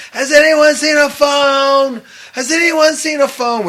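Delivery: loud, high, drawn-out street call; retold calling out. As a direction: holler it down the whole route, imitating a town crier. Friend calling out along the route.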